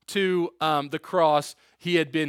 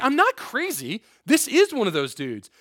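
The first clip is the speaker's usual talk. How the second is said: higher, emphatic mock protest; insistent servant girl; 8 semitones higher, 4 dB louder than usual. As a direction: insist in a raised, put-on voice.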